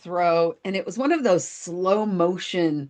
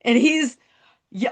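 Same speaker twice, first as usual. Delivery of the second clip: ordinary delivery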